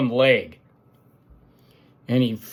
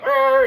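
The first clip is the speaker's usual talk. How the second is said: mock yell; 11 semitones higher, 5 dB louder than usual. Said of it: "And he's like".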